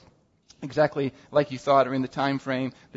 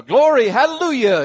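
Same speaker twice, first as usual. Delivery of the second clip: hammed-up churchy shout, higher and louder; overdone praise; 7 semitones higher, 8 dB louder than usual. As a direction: overdo the Glory hallelujah. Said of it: "throw in".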